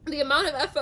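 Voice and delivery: ordinary talk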